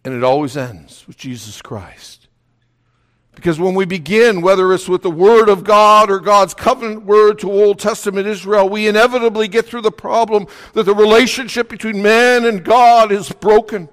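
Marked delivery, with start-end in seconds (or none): 3.3-13.9 higher, forceful pulpit voice; impassioned preaching. Real, not acted. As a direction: press the point home like a preacher.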